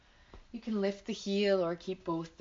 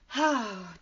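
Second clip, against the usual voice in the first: short audible sigh out; sigh breath; 3 semitones higher, 4 dB louder than usual. Shown as a demonstration. Movement teacher to class, letting out a sigh breath. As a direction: let a short open sigh go. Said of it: "let out several sigh breaths".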